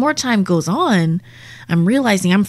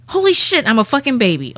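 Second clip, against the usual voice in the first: sudden high loud burst; shocked exclamation; 6 semitones higher, 3 dB louder than usual. Retold or imitated. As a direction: blurt it out in disbelief. Realizing she is a baby.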